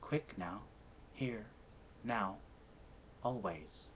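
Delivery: ordinary delivery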